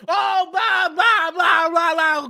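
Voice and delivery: high shouted nerdy blah-blah; mocking geeky guy. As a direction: parody the know-it-all's babbling. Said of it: "here comes geeky guy".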